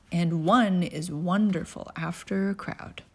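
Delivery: usual speaking voice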